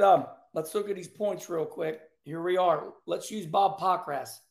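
ordinary delivery

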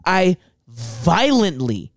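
drawn out for emphasis; slow and emphatic. Real, not acted. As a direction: spell out every word slowly. Emphatic complaint about Taco Bell.

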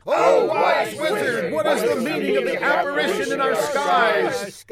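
high hammed-up group shout; crowd yell. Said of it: "the crowd yelled".

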